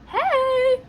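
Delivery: high, loud "hey"; retold greeting. Arriving at her house, high bright-pitched greeting.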